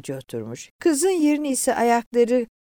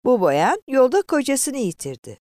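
raised, sneering report; conductor's taunting explanation; sly, mocking; 6 semitones higher, 4 dB louder than usual. A narrator's reading. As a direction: answer with sly mockery. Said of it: "sinsi ve alaycı bir tavırla".

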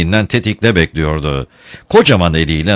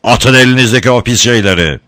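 raised voice, pitched up; thundering order; 4 semitones higher, 4 dB louder than usual. A narrator's reading. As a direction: command them like a moderate thunderclap. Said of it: "gök gürültüsü gibi bir ses".

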